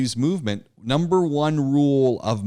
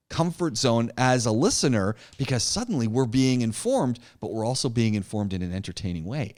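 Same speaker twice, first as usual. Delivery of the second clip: normal talking voice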